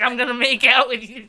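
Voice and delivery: loud, laughing imitation of a blunt date; mock pushy date. Mocking dates in the book, a loud laughing impression. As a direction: ham up a pushy guy announcing a kiss. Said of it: "so they're just like".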